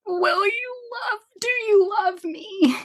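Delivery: high, wavering plea, half-crying; tearful plea. Retold or imitated. Pregnant wife asks husband if he loves her, high and tearful. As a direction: replay her own teary question to her husband, high and wobbly.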